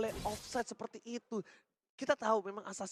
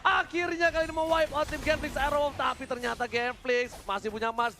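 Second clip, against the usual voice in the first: fighting game announcer; 6 semitones higher, 3 dB louder than usual. Real, not acted. Fast esports play-by-play climax.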